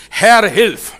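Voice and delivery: loud, high plea to God; desperate loud prayer cry; loud cry for help. Shown as a demonstration. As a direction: call out Herr, hilf urgently. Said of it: "In dem Moment, wo du rufst".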